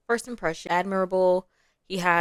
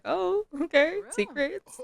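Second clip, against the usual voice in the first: intrigued; 11 semitones higher, slower than usual. Acted. Voices sim let in on secret.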